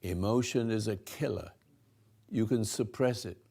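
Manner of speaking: usual speaking voice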